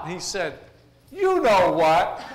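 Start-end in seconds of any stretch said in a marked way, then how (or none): 1.1-2.2 loud, slow, high sly parrot voice; knowing parrot punchline. Acted. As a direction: drag it out, loaded with meaning.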